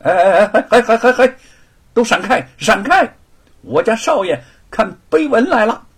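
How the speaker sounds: loud, high yelling to clear the way; bossy shouting. Acted. Servant shouting at the crowd to make way for his master. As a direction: bark at the crowd like an arrogant lackey. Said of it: "便嚷嚷道".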